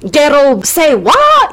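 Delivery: slow, high, struggling Scottish accent; attempted Scottish accent. Shown as a demonstration. Host trying a phrase in Scottish, slow and exaggerated accent. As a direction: stretch it out in a botched Scottish accent. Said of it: "say that with a Scottish accent".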